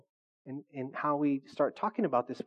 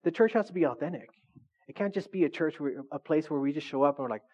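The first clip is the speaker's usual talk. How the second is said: ordinary talk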